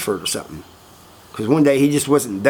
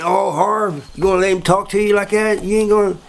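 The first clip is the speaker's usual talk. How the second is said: higher-pitched mocking prod from the guys; goading tease; 6 semitones higher than usual. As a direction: egg him on in jest, voice raised in pitch. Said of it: "they're joking".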